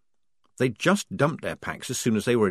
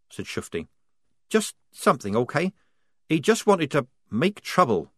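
higher, evasive and hurried; higher timid girl; 6 semitones higher than usual. A narrator's reading. Wazza hiding what was stolen, higher and evasive. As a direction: brush off the question.

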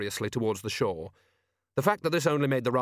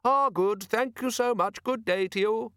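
high tinny courteous farewell; prim little tinny voice, pitched up; tinny, polite; 10 semitones higher than usual. A narrator's reading. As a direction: thank them politely in a piping voice. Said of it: "in a tinny yet polite voice".

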